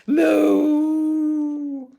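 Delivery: long loud high moo. Acted. Puppy George mooing loudly instead of barking. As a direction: hold out a silly cow moo.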